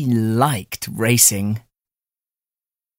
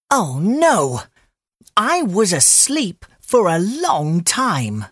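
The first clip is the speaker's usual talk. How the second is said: alarmed rabbit; 6 semitones higher than usual. Acted. Rabbit wakes, realises he slept.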